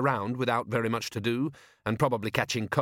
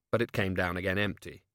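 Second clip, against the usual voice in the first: ordinary delivery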